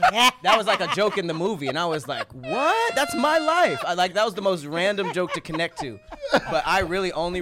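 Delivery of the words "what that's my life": high-pitched, incredulous retold reaction; amazed outburst. Recalling a Deadpool joke that hit home, amazed. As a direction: leap up high in disbelief, re-enacting it.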